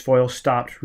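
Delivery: ordinary delivery, nothing marked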